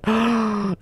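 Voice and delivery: sharp intake of breath; delighted gasp. Real, not acted. Gamer seeing the leather interior, gasping. Looks inside the car.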